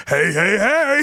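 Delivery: mock yell. Mock reaction stepping on Jell-O.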